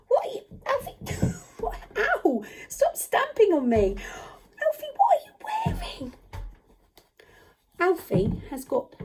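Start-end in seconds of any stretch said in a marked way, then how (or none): 0.1-6.1 high-pitched playful alarm; mock outrage. Teacher to a stamping puppet, mock-pained protest. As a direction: pretend to be stamped on, voice shooting up.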